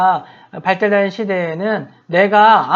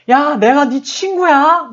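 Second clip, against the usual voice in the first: retold indignant challenge, higher and louder; slow, raised-voice scolding; angry outburst; 8 semitones higher, 5 dB louder, much slower than usual. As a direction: stretch it out in mock outrage at a cheeky kid. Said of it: "화가 나니까".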